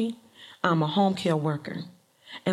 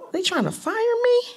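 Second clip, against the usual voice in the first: much higher, incredulous question; stunned, voice jumping up; shocked disbelief; 13 semitones higher than usual. Retold or imitated. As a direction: ask it as if you cannot believe it. Shocked by employer's text.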